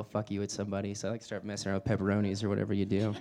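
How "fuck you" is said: ordinary talk, nothing marked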